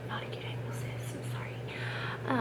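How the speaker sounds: hushed whisper to camera; quiet whisper, don't wake anyone. Real, not acted. Whispering so husband sleeps.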